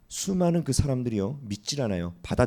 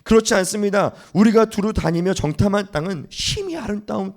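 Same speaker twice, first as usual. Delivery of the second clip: high, loud, fervent declaration; bold confident rebuttal; 6 semitones higher, 4 dB louder than usual. Acted. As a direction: proclaim the good land with conviction. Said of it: "옷을 찢으면서 말합니다". (여호수아와 갈렙이 백성에게 높고 힘차게 선포하듯)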